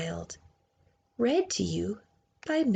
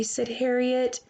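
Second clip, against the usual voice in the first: ordinary delivery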